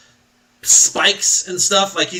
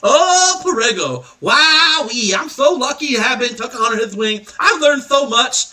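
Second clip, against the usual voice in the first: put-on awestruck kid; 7 semitones higher than usual. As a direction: marvel like a starstruck child. Kid gushing in awe.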